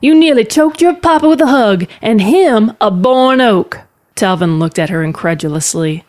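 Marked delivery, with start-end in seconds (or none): 0.0-3.8 high, emphatic calling voice; yelling encouragement. Acted. Aunt reminds niece of her strength, yelling. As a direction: call out forcefully, pitched well up.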